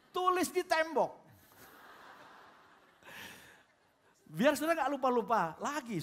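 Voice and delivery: playful hammed-up shout to the wives; loud punchline. Shown as a demonstration. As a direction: land the joke with a big comic shout.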